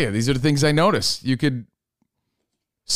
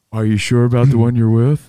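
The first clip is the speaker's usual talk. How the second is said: lower, louder musing voice; deep cat voice; 4 semitones lower, 5 dB louder than usual. Retold or imitated. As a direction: ponder it like a sage cat. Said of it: "It wasn't telling me to".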